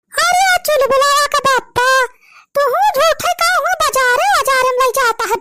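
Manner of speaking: loud village wife. Acted. Wife calls husband loudly.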